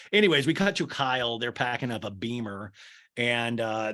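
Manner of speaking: ordinary talk, nothing marked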